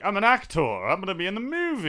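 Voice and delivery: high, hammed-up proclamation; self-mocking aspiring actor. Explaining why he never wanted announcing, mock-grand actor boast. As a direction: announce it grandly, poking fun at yourself. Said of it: "'cause I was like".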